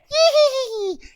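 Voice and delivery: high loud nasty creature cackle; monkey cackle. Acted. Nasty fire monkey holding rock.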